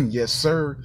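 ordinary talk

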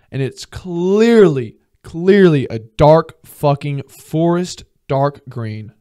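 loud, slow, word-by-word insistence; emphatic exasperation. Real, not acted. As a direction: drag out CLEARLY and punch each word loudly. Exasperated rant about dark colors.

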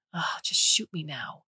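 whispered, fed-up groan of words; exasperated whisper. Acted. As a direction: mutter it in a hopeless whisper. Cora's exasperated aside.

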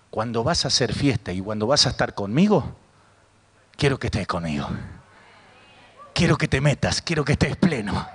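low, intimate voice of God; tender appeal. Retold or imitated. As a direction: drop low and invite them closer. God tenderly calls the listener. (súplica íntima, en voz grave)

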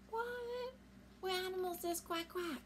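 high, soft gasping disbelief; shocked. Acted. Mother hears her puppy quack, high startled question. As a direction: ask in high startled wonder.

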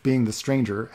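ordinary delivery, nothing marked

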